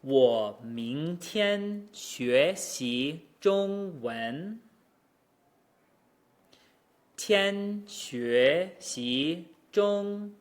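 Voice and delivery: 我明天学习中文 stretched very slowly, syllable by syllable. Shown as a demonstration. Teacher slowing the sentence to show the tones. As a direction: drag each syllable out. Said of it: "run by these syllable by syllable".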